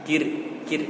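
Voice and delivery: "Kir" with a light R, lips spread; thin final R. Shown as a demonstration. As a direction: smile through "Kir" for a thin R. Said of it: "maka dibaca tipis".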